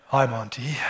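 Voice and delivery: extremely deep bass voice; deepest voice. Retold or imitated. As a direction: drop to the lowest note you can. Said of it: "reached down for the deepest voice I could muster up".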